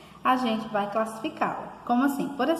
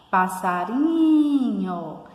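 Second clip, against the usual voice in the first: "passarinho" with a long, loud, raised "ri"; slow, sung-out "passariiinho"; calling-out stress; 6 dB louder, much slower than usual. Shown as a demonstration. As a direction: call out "passarinho", holding the "ri". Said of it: "quando a gente pronuncia".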